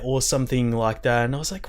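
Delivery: ordinary delivery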